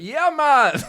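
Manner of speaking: high, loud Isle of Wight crowd "yeah man"; mock the Isle of Wight accent like a gig crowd; shouted Isle of Wight accent imitation. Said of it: "everybody's doing it in the accent".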